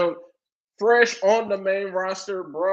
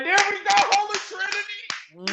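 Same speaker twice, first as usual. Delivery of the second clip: much higher, thrilled cheer; excited cheer; 13 semitones higher than usual. Retold or imitated. Standing up cheering Oba's promo, high excited cheer. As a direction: jump up and cheer it. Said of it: "I had to stand up 'cause I was like".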